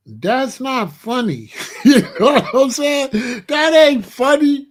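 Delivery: high, loud laughing complaint; laughing protest. Real, not acted. Son protesting his mother's joke, laughing high and loud.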